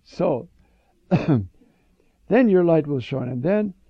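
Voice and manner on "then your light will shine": ordinary talk, nothing marked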